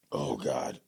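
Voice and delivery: strained coughing after a shot; coughing fit. Real, not acted. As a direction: cough hard from the burn. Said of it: "my throat is on fire".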